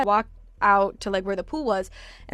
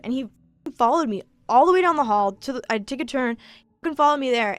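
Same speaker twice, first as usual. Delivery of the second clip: ordinary talk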